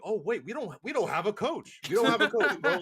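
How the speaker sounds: hammed-up dawning alarm; mock panicked realization. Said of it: "You're like".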